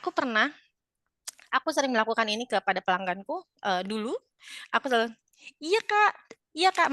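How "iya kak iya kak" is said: high, loud cheery acknowledgement; cheerful, smiling. Shown as a demonstration. Seller answering a customer, very cheerful and smiling. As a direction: answer pitched way up, with a smile. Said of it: "kita cheerful banget untuk mereka".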